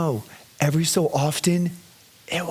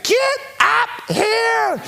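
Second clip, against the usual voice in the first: put-on child's demand; much slower than usual. Daughter ordering father upstairs, high slow yell. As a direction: summon him like a tiny boss. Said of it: "I could just hear her be like".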